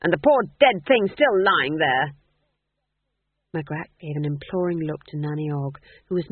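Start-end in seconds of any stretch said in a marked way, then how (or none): 0.0-2.2 loud, scandalised complaint; outraged old witch, voice raised; indignant snap. A narrator's reading. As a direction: carry on snapping in disapproval. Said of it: "snapped Granny".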